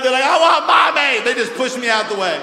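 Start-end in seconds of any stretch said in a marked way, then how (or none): 0.2-1.2 put-on high, loud childish whine; mock child demanding mommy; whiny kid. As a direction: pout like a hurt little kid. Kid voiced wanting mom.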